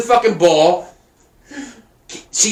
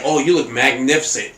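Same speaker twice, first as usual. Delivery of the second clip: a lower, suave, lightly voiced prince; mock prince; 9 semitones lower, 4 dB quieter than usual. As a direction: croon a compliment like a smug prince. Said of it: "he's like".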